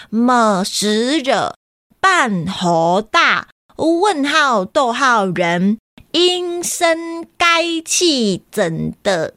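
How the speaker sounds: syllables garbled and stretched slowly, 半猴大 and 阴声该气怎的 drawn out; silly misreading. Acted. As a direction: deliberately misread 么时惹 半猴大 逗号人 as nonsense. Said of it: "好像怪怪的耶".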